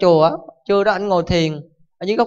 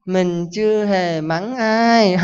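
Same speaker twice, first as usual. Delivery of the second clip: very slow calm monk; much slower than usual. Acted. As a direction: answer serenely and drag every word. Calm monk replying to the father.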